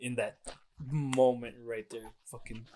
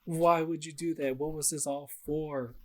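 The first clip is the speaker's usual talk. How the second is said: higher, anguished why; despairing Odin; 6 semitones higher than usual. Retold or imitated. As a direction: cry out in disbelief at the loss. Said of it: "Odin's just like".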